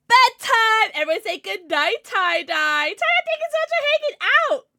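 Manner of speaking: high bright goodbye; excited high sign-off. Real, not acted. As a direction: call it out cheerfully. Loud high sign-off to pet.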